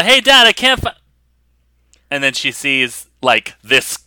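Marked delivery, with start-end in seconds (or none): 0.0-0.9 put-on high, loud teenage daughter; daughter calling to dad. Daughter comes from the back room, calling to dad. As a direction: call out to your dad, voice pitched up. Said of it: "Mary actually comes from the back room".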